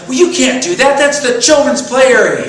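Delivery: high, loud outraged scolding; indignant protest. Retold or imitated. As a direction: scold him, fired up and shrill with indignation. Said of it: "And I went".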